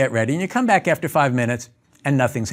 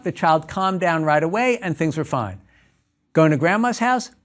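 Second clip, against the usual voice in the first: normal talking voice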